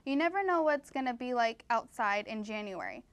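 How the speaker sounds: ordinary talk, nothing marked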